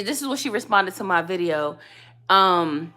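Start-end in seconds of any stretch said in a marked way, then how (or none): none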